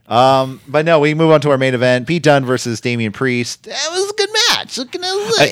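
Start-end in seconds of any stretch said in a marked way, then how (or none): 3.6-5.5 very high mock voice; put-on squeaky voice. Podcaster comments on main event, absurdly high put-on voice. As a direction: push it way up into a silly high pitch.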